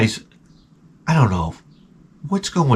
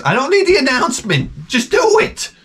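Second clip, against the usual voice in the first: exasperated dad; 12 semitones higher than usual. Retold or imitated.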